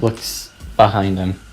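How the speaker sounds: ordinary delivery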